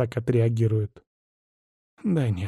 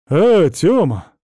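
louder, drawn-out happy hail; delighted greeting; 4 semitones higher, 6 dB louder, slower than usual. A narrator's reading. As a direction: greet an acquaintance gladly, voice lifted. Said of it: "обрадовался он". (обрадованный возглас)

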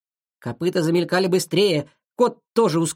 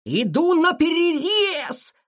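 high, drawn-out charging yell; barked battle cry; 12 semitones higher, slower than usual. A narrator's reading. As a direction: holler as you burst in to cut him off. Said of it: "рявкнул Шиша". (рявкнуть протяжно, высоким голосом)

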